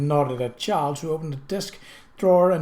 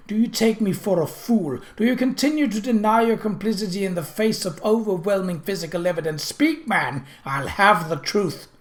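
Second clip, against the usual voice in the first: thundering; 6 semitones higher, 4 dB louder than usual. Acted. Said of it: "he thundered".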